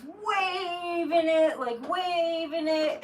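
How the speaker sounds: "waving" stretched out high and loud; exaggerated emphasis. Describing a kid with a giant flag, exaggerated drawn-out emphasis.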